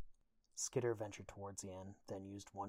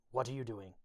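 touch of desperation, louder; desperate; 3 semitones higher, 8 dB louder than usual. A narrator's reading. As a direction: ask it urgently with mild desperation. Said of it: "a note of desperation in her voice".

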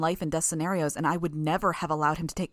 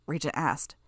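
plain reading voice

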